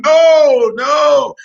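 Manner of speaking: loud, high, drawn-out refusal; mock protest. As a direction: cry out an exaggerated protest. Acting out refusal of strong stuff.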